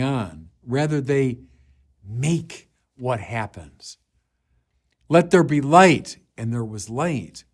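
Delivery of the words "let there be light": commanding voice. Acted. Quoting God's creative command.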